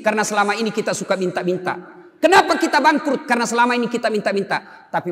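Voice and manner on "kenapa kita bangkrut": raised, louder rhetorical question; rhetorical call. Real, not acted. Preacher calling out a question to the congregation, raised and loud.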